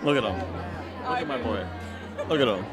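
normal talking voice

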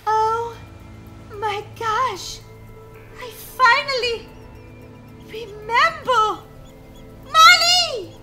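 loud, high, elated outburst; screaming her name. Acted. Girl calls Molly over a sudden memory, screaming. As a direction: cry out at the top of your voice. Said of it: "Why are you screaming my name".

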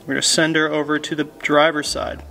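usual speaking voice

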